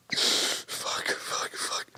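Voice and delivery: whispered curses breaking into tears; crying whisper. Retold or imitated. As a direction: sob the curses under your breath.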